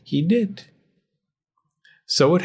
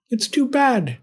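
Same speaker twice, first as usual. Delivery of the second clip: put-on old woman voice; 12 semitones higher than usual. A narrator's reading.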